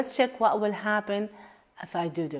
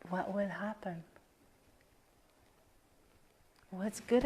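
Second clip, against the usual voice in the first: soft inner inquiry; 5 dB quieter than usual. Acted. Coach softly questioning her own resistance, quiet and searching. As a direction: ask gently inward, ending in a whisper.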